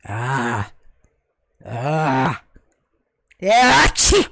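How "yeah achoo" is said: loud fake sneeze. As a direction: sneeze it out big. Acted sneeze.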